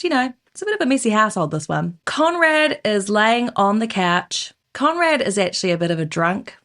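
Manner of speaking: usual speaking voice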